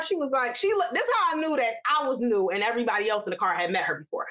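usual speaking voice

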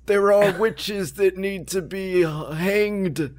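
high, slow proclaiming crier voice; town crier. Acted. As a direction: call out like a village crier. Mock olden-days crier announcement.

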